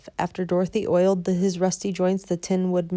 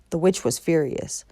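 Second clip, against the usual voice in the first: usual speaking voice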